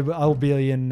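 usual speaking voice

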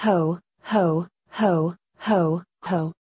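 a lightly voiced jolly ho-ho-ho laugh; gentle storybook Santa laugh, not too big; Santa's laugh. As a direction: chuckle it out like a hearty Santa. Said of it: "laughed Santa".

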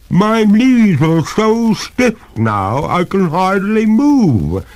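high, loud, slow complaint; distressed ghost lady. A narrator's reading. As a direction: implore as a freezing ghost, pitch way up. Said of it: "signs of distress".

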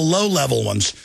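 ordinary delivery

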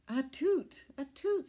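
high, loud sneezing toot; squeaky tugboat sneeze. Acted. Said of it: "sneezed Alice".

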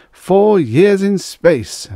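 loud, high, drawn-out oratory; public address. A narrator's reading. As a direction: proclaim it slowly to a crowd. Mayor continues his speech.

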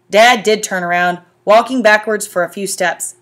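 ordinary delivery, nothing marked

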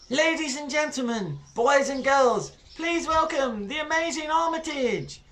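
high, showy little ringmaster; girl announcer. Acted. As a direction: announce the act grandly. Girl announcing stage act.

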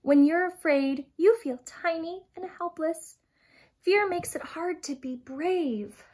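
usual speaking voice

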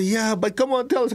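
coaxing students. Retold or imitated. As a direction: coax it out of him. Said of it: "And people say".

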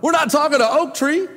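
ordinary delivery

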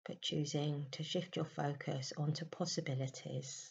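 soft, unhurried meditation voice with long pauses; guided meditation. Acted. Leading listeners into a May meditation, soft and soothing.

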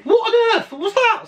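very high, startled squeal; shocked outburst. Real, not acted. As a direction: voice leaps up in real shock. Shocked by sudden windscreen smash.

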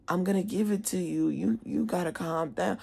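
soft, placating voice; imitating calm staffer. Staff member telling angry student to calm down, soft imitation. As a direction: soothe softly, holding the line.

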